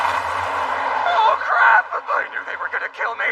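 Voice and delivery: high, loud panic; panicked. Acted. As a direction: scream while falling. Panicked falling.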